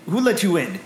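calling out. Acted. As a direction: call out an indignant demand. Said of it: "the older voice calls out".

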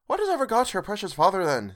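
a bustling mother asking after her husband; Mrs Cratchit voiced. A narrator's reading. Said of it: "said Missus Cratchit".

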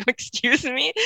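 high, stunned excuse me; as if you misheard an insult; indignant disbelief. Retold or imitated. Mother reacting to the night nurse's torture remark, stunned and high.

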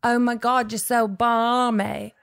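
put-on British accent with a drawn-out last word; amateur imitation of a British accent; attempted British accent. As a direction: have a go at a British accent, slowly. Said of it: "Is that my accent? Okay, wait. I'm gonna try it".

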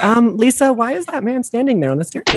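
high mock-mom voice; mock puzzled mom. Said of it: "She's like".

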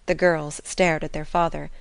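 ordinary delivery

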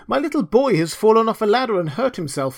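high, urgent pleading; distressed miller. A narrator's reading. Miller begging Hans for help, distressed.

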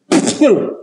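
a high, strangled throat-clearing gurgle; imitated choking gurgle. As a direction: mimic a man choking on his salad, high in the throat. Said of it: "I hear this".

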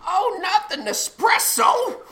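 high slow anguished cry; dramatic wail. Acted. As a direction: wail it like a tragedy. Mock dramatic outburst.

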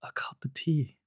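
whispered confession. Real, not acted. Whispering to his co-host that he's drinking tea. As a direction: hush it like a guilty secret.

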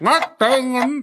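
very slow garbled name round a mouthpiece; mouth full. Real, not acted. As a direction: force the name out past a mouth guard. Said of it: "what I'm saying with my mouth full".

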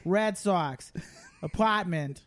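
deliberate Boston catchphrases; Boston accent. Acted. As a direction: play a Boston sports fan. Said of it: "that was my Boston accent".

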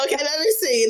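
ordinary talk

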